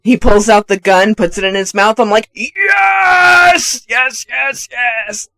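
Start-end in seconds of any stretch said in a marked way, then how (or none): 2.3-5.3 hammed-up shout of delight; ecstatic cheering. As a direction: erupt in triumph, easing off after the first. Climax of escalating retold cheers.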